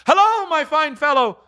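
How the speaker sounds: high, loud hearty hail; calling down. Acted. Scrooge hailing the boy below, high hearty call. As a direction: holler a cheerful greeting below. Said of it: "calling downward to a boy".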